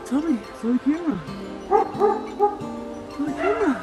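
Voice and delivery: high playful mock scolding; talking to a dog. Real, not acted. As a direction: tease the naughty dog.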